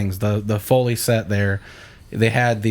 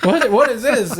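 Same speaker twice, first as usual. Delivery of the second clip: high, louder baffled blurt; mock bewilderment; 11 semitones higher, 3 dB louder than usual. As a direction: blurt it high, completely thrown. Retelling reaction to early morning call.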